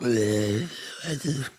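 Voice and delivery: slow, high creepy whine; creepy villain voice. Retold or imitated. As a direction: play it like a sad, creepy villain. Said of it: "He's gonna be creepy and like".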